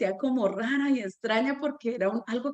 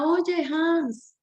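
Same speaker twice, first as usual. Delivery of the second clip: mock yell, pitched up; retold shout; 6 semitones higher than usual. Teacher calls to a boy across the church, retold shout. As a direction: call out higher, as if across a church. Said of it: "le grité desde la mitad de la iglesia".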